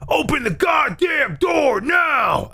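high, slow mock shout, every word stretched; angry shouting. Stranger yelling through the door at a sleeper, mock angry shout. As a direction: imitate a furious demand to get in. Same man demanding entry, retold.

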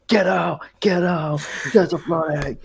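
loud, high-pitched mock alarm; imitated warning shout. Imitating staff banging on students' doors, shouting. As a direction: yell it like you're rousing the halls. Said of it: "just shout".

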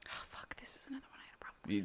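hushed exasperated whisper; whispered curse. Real, not acted. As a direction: mutter it under your breath in dismay. Sudden realization, muttered aside.

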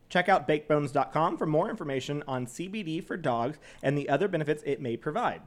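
even, scripted sponsor read. Real, not acted. Co-host reads dog CBD sponsor copy, announcer-like. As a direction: read the copy clearly and steadily.

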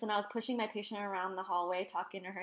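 ordinary delivery

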